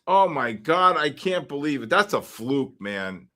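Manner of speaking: normal talking voice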